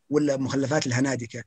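normal talking voice

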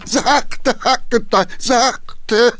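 high, loud panicked cry; shouting. Acted. Councillor shouting at the coachman to go gently. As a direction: yell at him to slow down. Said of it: "schrie der Geheimrat".

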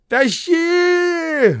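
very high, loud howl; howling shout. A narrator's reading. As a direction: howl up from the well. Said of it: "выл Губин".

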